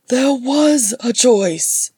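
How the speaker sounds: high, loud, slow outcry; anguished cry. Acted. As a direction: cry it out in despair. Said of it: "Balin cried".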